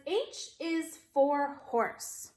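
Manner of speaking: slow, even model sentence; slow modeled reading. Shown as a demonstration. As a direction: read it slowly for kids to follow. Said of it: "Read it with me".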